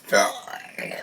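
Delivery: belch the word out; burping the words. Shown as a demonstration. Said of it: "say it in burping style".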